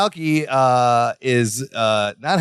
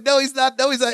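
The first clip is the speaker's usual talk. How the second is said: higher-pitched mock bickering; arguing back; 6 semitones higher than usual. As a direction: contradict him in a raised pitch, playfully imitating a squabble. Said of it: "they start arguing".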